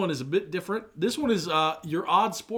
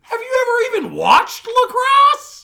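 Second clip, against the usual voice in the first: very high, very loud incredulous yell; shrieking sports-argument indignation; outraged shout; 13 dB louder than usual. Real, not acted. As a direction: screech the question in disbelief.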